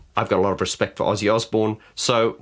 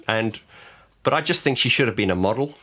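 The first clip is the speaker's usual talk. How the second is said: ordinary delivery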